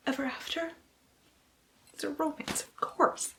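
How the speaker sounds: hushed whispered punchline. Acted. Booktuber whispering the obvious ending, whispering. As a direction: drop to a breathy whisper.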